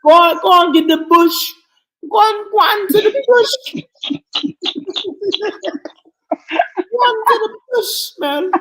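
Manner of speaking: high, loud scolding mama; imitating mama. Friend mimicking a mother ordering a kid outside, high and loud. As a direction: shout like a mama sending a kid for a switch. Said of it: "your mama say".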